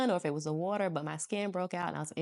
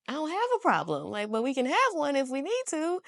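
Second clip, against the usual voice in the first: mock confrontational; 7 semitones higher than usual. Acts out combative reply from home.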